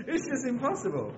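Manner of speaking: high, amused, tailing out of laughter; laughing. Real, not acted. Preacher mocking catwalk walking to the church, high and laughing. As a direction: say it through the last of a giggle.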